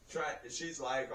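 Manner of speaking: ordinary delivery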